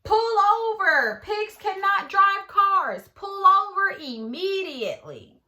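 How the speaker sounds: loud, high, slow commands; bullhorn shout. Acted. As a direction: order the car to stop through the megaphone. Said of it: "shouted in his bullhorn".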